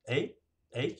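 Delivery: mock Canadian accent, "hey" as "eh". Shown as a demonstration. Host coaches guest on the Canadian accent, Canadian "eh". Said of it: "you gotta say".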